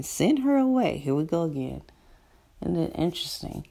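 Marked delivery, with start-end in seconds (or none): 0.0-1.0 raised, higher mock push to send her off; irritated dismissal, pitched up and louder; impatient disciples. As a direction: voice the disciples brushing her aside. Disciples urging Jesus to dismiss her.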